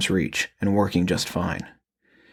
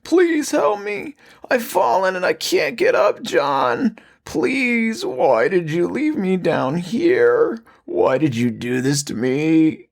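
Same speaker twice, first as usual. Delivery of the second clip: much higher and slow, creature begging its son; raspy pleading; 10 semitones higher, slower than usual. Acted. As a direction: drag out a monstrous plea for help. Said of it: "the thing on the ground pleaded".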